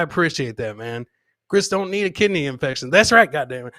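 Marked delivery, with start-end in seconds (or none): none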